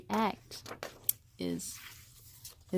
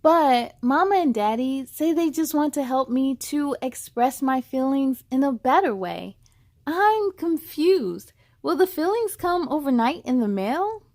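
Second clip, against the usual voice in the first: put-on louder storybook narration for kids; expressive picture-book reading; story reading; 3 semitones higher, 7 dB louder than usual. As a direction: read to children, lively. Reads the story text.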